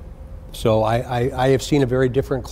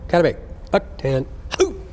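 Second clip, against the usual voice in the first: barked call to attention; sharp high command call; drill sergeant; 5 semitones higher than usual. Real, not acted. Calling class to attention.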